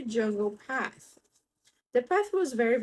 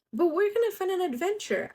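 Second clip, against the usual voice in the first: penguin voice; 10 semitones higher, faster than usual. Acted. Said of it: "asked the Little Penguin".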